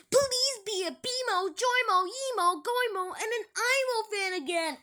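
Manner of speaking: high-pitched acted tantrum; angry rant. Vlogger reading an angry commenter's post, high mock rant. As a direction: whine it out high, worked up. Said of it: "he keeps getting angry".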